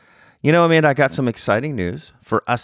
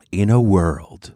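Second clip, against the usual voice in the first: movie trailer voice; slower than usual. Shown as a demonstration. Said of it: "I'm gonna try to do this now".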